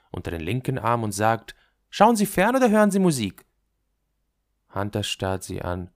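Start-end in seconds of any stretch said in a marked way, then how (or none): 1.9-3.5 put-on loud, high, quick girl's voice; forward girl. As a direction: fire the question out high and loud. Girl accosting Hunter in story. (freches Mädchen, laut und hoch)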